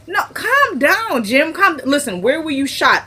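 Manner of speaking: high-pitched retold plea to settle down; urgent calming. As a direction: cut in high to rein him in. Calming agitated patient in retelling.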